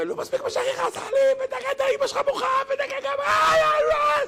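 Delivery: high-pitched, shrieking mock wail; a histrionic, squealing parent; wailing old father. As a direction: ham up a father's crying complaint. Rabbi acts wailing father.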